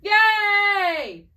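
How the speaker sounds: loud, high cry of joy; excited cheer. Real, not acted. Cheering, friend will try one.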